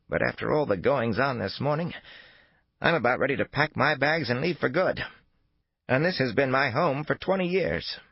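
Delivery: put-on high, worked-up old woman. A narrator's reading. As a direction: complain like a rattled old lady. Old woman character voiced.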